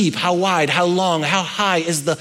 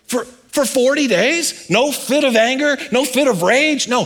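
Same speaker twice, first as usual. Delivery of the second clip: emphatic preaching; 8 semitones higher than usual. Real, not acted. Preacher marvels at God's patience, high and emphatic. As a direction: hammer home the forty days.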